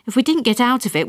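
ordinary delivery, nothing marked

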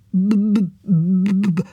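put-on frightened young man stuttering on the first sound; stammering in fear; nervous stammer. A narrator's reading. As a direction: trip over the B again and again. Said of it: "stammered the young fellow".

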